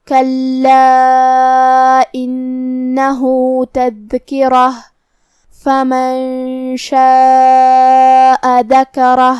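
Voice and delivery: slow, drawn-out tajweed chanting; measured Quranic tilawat with long vowels; Quran recitation. Acted. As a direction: recite the verse slowly and melodically. Teacher recites Quran verse.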